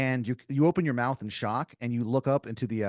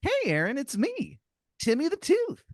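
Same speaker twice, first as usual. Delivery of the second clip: high squeaky cartoon tooth voice; a grinning little tooth introducing himself; squeaky cartoon tooth, cheerful; Timmy the Tooth. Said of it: "And he goes".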